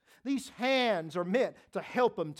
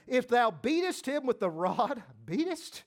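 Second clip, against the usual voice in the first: beatest repeated higher with a laugh; stress on beatest; 4 semitones higher than usual. Acted. Preacher rereading the verse to stress beatest, amused.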